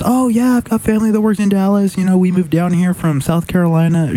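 eager, high-pitched small talk; imitated chatty stranger. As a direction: gush like a talkative bar neighbour. Acting out chatty stranger's eager reply.

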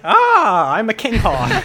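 loud, high, laughing bird boast; proud hawk voice. Pretend. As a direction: crow it out like a king hawk. Voicing a hawk in photo.

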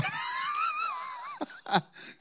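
sudden high-pitched laugh; burst of laughter. Real, not acted. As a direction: break into a real laugh. Laughs at own joke.

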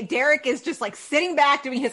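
ordinary delivery

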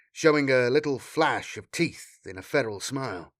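usual speaking voice

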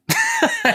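short squeaky laugh; a high laugh breaking up the bit. Real, not acted. Laugh after the failed bit.

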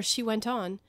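usual speaking voice

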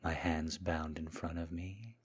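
soft, hushed, lingering murmur; submissive seduction. Acted. Kneeling man serving his Mistress, soft seductive submission.

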